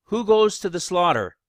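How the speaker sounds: normal talking voice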